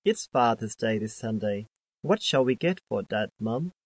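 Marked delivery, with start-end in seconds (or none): none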